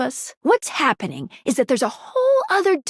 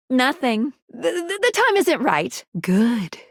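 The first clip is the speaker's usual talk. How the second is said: ordinary delivery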